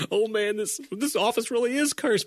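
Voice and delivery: high, hammed-up weepy boss voice; mock crying Michael. Boss lamenting the dead cat, mock weepy and high. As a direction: whine it as if about to cry. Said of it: "starts cry".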